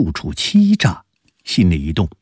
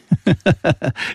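a quick high chuckle that falls away; short laugh; 11 semitones higher than usual. A narrator's reading. Scholar chuckling before he answers the old lady, a short laugh. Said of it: "笑着说".

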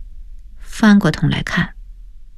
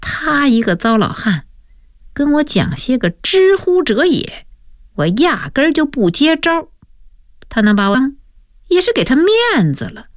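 raised, cocky boasting; smug; 7 semitones higher than usual. A narrator's reading. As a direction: brag like a pleased young show-off. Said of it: "发财得意地说". (得意洋洋地说)

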